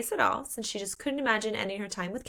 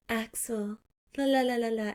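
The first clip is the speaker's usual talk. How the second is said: high sing-song la-la taunt; flirty, mocking little trill; playful teasing; 3 semitones higher, 3 dB quieter than usual. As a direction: lilt it lightly to tease. Acts her teasing Axel.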